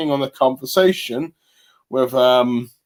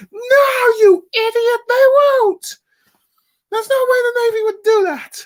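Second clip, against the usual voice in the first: very high, louder hysterical shriek; screaming Usopp; 4 dB louder than usual. Retold or imitated. Reviewer voicing Usopp yelling at Luffy, shrill comic scream. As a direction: scream it at him in comic panic. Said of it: "Usopp's going like".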